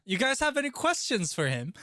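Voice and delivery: loud, high-pitched question to chat; squealed question to the viewers; high excited call. Real, not acted. Calling out to chat, then repeated calmly.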